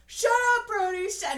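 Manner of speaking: loud high mock yell; retold yell. Older brother snapping at teasing younger brother, loud. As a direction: yell it as an annoyed big brother. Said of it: "Caleb's all".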